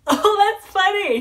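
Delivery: high, loud delighted reply; retelling a star's thrilled reaction; excited quote. Retold or imitated. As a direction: burst out with delight. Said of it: "And Callum's like".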